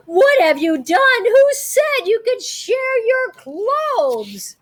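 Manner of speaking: gasp, then a high slow loud outcry; shocked yell. Acted. Teacher horrified the kids swapped clothes, loud shocked yell. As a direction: gasp and cry out in horror. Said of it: "The teacher yelled".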